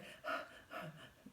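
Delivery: gasping for air; breathless gasp. Retold or imitated. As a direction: catch your breath with a gasp. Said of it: "still out of breath".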